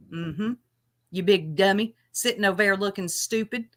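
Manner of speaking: ordinary talk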